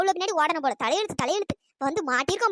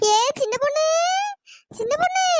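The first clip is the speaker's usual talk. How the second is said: eerie ghost call; 7 semitones higher than usual. Acted. The bungalow woman calls in a strange voice, lightly eerie. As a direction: call out like an unseen spirit luring them. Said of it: "பங்களாக்காரி தான் வேற குரல்ல கூப்பிடுறாளோ".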